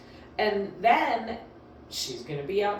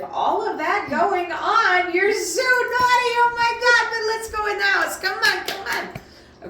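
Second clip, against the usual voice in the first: high, loud happy voice saying angry words; happy-voiced telling off of a naughty dog; cheery scolding; 9 dB louder than usual. Shown as a demonstration. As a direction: scold the dog in a delighted sing-song. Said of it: "I can tell dogs I'm very, very angry".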